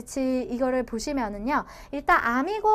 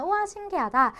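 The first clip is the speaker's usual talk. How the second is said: mock amazement; 6 semitones higher than usual. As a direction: play a student marvelling at it. Mock student amazed at grammar. (신기해하는 학생 흉내로 감탄하며)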